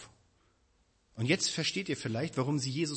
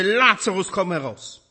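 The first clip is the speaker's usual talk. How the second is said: louder, commanding call from the tomb's entrance; Jesus calling Lazarus from the tomb, louder; 5 semitones higher, 8 dB louder, slower than usual. Retold or imitated. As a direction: call him out of the grave. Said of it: "rief er mit lauter Stimme".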